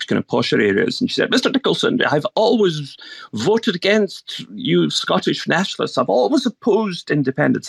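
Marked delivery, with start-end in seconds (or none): 1.3-7.7 posh voice. Retold or imitated. Elderly lady in a posh area tells the candidate she always opposed him, in a put-on posh voice. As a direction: lift the pitch and play a prim posh lady. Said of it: "This is my posh voice I'm putting on here".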